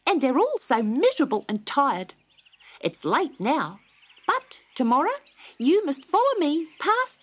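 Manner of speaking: a busy little bird; chirpy wagtail. A narrator's reading. Willy Wagtail chats to Dot, high and chirpy. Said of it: "he said".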